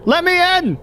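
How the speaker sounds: high, insistent mock shout; exasperated high-pitched yell. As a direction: demand to be let in. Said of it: "he's yelling".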